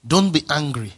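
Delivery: usual speaking voice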